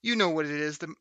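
upbeat host ad read; podcast promo read. Acted. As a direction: sell the show like an ad.

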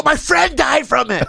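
high, drawn-out mock outrage; heated retort. Narrator lying to maskless man, heated retort. Acted quarrel, the lie.

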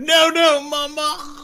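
high, loud pleading cry; pleading no mama. Retold or imitated. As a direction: beg like a scared child. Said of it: "you just heard".

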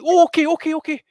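high, flustered mock compliance; meek backing down. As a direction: play the startled woman hurriedly giving in. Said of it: "And she's like".

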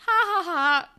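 high, loud fake "ha ha ha"; hammed-up spoken laugh; mock laugh. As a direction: imitate a forced laugh. Acting out his laugh before leaving.

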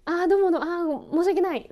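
quick automatic apologies, pitched high and loud; imitating your own habitual over-apologizing; reflexive apologizing. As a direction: toss out high, eager sorries without meaning them. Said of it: "そこまで謝罪の意思はないんだけど".

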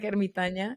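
ermitaña said as a Spanish word; switching between two languages. Real, not acted. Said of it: "how do you say in English?".

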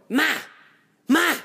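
loud, high imitation of wife; wife calling out. Wife shouts for her mother during FaceTime, loud call. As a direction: yell for Mom like the wife. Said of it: "my wife going".

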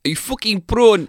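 high, exaggerated South African accent; mock South African accent. Co-host teasing ahead of the trailer, mock South African accent.

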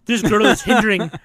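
loud, high, hammed-up indignation; Shia LaBeouf, jealous. Playing a jealous underling complaining about a girl, indignant. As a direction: declaim it with mock jealous outrage. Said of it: "gets all jealous 'cause he's all like".

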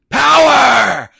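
huge high drawn-out shout; screamed movie villain. Retold or imitated. Podcaster imitates Palpatine's big moment, long hammed-up scream. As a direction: bellow it like a villain unleashing his powers. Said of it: "then he starts screaming".

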